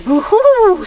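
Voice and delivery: high, loud owl hoot; owl screech. Acted. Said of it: "screeched Owl".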